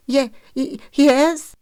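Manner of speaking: high, halting stammer; frightened child. A narrator's reading. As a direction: stutter out a scared little boy's answer. Terrified boy answering.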